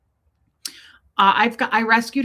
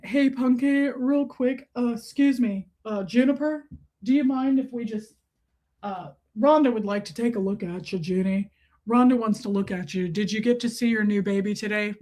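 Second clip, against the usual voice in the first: lightly put-on voice for addressing a dog; pet-talk voice. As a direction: coax a little dog politely. Talks to her dog in a put-on voice.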